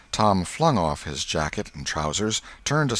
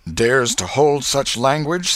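high, loud, slow prompt; feed the line slowly; 7 semitones higher, 6 dB louder, slower than usual. A narrator's reading. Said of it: "said Tom, prompting".

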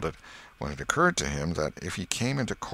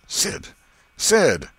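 impatient call, louder and higher on the repeat; calling out, getting more insistent; aggravated; 10 semitones higher, 7 dB louder than usual. Acted. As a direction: call to rouse a sleeper, annoyed. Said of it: "Tom was aggravated".

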